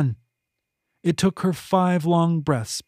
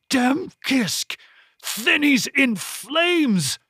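much higher, drawn-out roar of a curse; shattering cry; 11 semitones higher, much slower than usual. A narrator's reading. Monstrous foe cursing through the storm, shattering cry. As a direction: draw the words out high, in a villain's voice. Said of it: "Yeeple's voice shattered the air".

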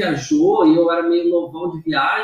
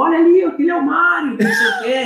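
much higher-pitched mock exclamation; raised, playful spotting call; imitated excited girl; 10 semitones higher than usual. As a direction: mimic a girl pointing out someone famous. Acts girl spotting him at airport.

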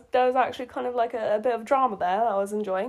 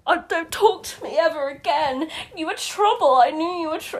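high, melodramatic outburst; mock teenage drama; 7 semitones higher than usual. Reader mimics Marceline dumping Marshall, in mock melodrama. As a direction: overplay a wounded teenager's tirade.

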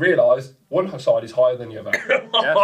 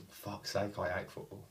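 muttered thought; 4 semitones lower, 17 dB quieter, slower than usual. Retold or imitated.

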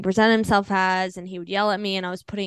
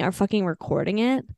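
usual speaking voice

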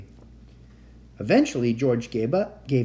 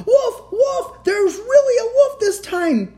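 very high, loud alarm cry; shouting; 9 dB louder, slower than usual. A narrator's reading. Shepherd boy running into town to trick everyone, high shouting. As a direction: raise the alarm at full pitch.

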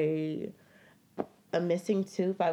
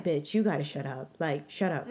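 lower-pitched, held-back irritation in her head; inner annoyance; 6 semitones lower than usual. Retold or imitated. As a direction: keep the annoyance contained and low. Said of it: "and I'm like".

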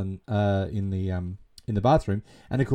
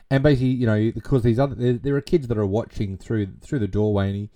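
ordinary talk

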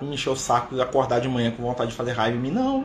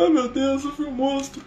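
mock wailing; 13 semitones higher than usual. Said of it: "começou a chorar na reunião".

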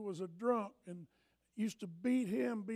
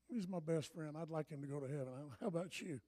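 ordinary delivery

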